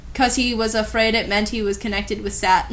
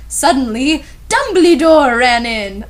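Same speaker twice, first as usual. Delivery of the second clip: loud, slow announcement; mock-dramatic storyteller; dramatic narration; 2 semitones higher, 7 dB louder than usual. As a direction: build it like a big reveal. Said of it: "dramatic reading".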